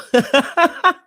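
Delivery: a loud, high laugh; burst of laughter. Real, not acted. Laughing at the clip.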